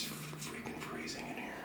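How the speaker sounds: shivering whisper from the cold; teeth-chattering cold whisper. Real, not acted. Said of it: "I'm literally shivering right now".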